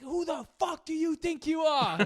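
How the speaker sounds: high mock yell of a furious driver; mimicking a Revere driver's high, raging scream; angry old guy. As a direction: imitate the Jeep driver screaming. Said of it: "he's just screaming at him".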